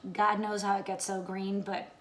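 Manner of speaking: ordinary talk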